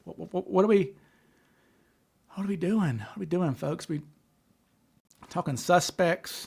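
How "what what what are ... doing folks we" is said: ordinary talk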